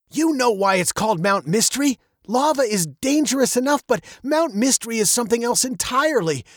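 high, lightly voiced cautioning dad; warning father. A narrator's reading. Father warns daughter off the volcano, cautioning. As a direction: list the dangers to put a child off.

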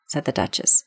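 ordinary delivery, nothing marked

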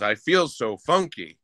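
ordinary delivery

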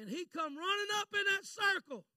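sudden high-pitched burst of narration; animated storytelling. Real, not acted. Preacher recalling his pastor rushing up, animated storytelling. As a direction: jump up in pitch for the dramatic arrival.

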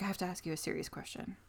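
hushed, fast serious question; quiet serious. Retold or imitated. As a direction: drop the voice and ask it gravely. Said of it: "he's like".

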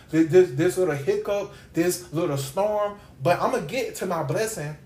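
ordinary talk, nothing marked

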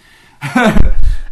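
short, loud high laugh; real sudden laugh. Real, not acted. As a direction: burst out laughing briefly. Laughs at his own story.

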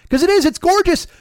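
high, loud sarcastic praise; mock gushing. Ranting about the fancy mall bathroom with sarcastic praise. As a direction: gush over the marble, sarcastically.

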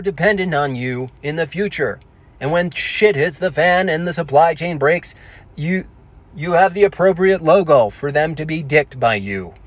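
stiff computer-voice monologue with falling phrase ends; Stephen Hawking. Acted. Dead man's hologram thanking Amazon, robotic synthesized impression. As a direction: deliver it as a speech synthesizer. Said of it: "Hawkins re-, rings the doorbell".

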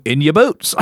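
joking Australian twang; Australian accent. Retold or imitated. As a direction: warn in a broad Australian accent. Voices Australians warning about spiders.

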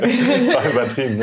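laughing while talking; words through a laugh. Real, not acted. Laughing at the reaction.

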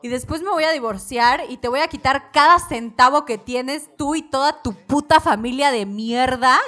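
loud, building furious bride's threat; furious retold rant. Bride furiously threatening her cheating groom, loud and rising. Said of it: "esta morra está tan envergada".

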